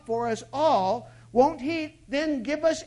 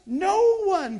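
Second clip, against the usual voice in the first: emphatic shout; 6 semitones higher, 6 dB louder than usual. Real, not acted. Preacher answers his own question.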